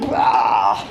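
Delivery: mock disgust at the belly; gross-out blah. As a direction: spit out a low blah. Mocks the menopause belly.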